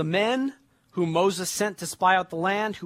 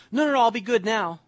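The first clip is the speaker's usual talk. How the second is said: put-on childish pleading; 6 semitones higher, 5 dB louder than usual. Child pleading after being refused.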